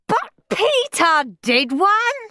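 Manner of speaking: high, drawn-out whiny protest; slow, high-pitched wail of unfairness; howling child. A narrator's reading. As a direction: howl it like a jealous little boy. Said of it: "howled Henry".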